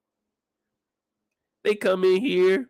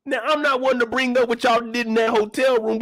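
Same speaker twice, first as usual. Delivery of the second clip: ordinary talk, nothing marked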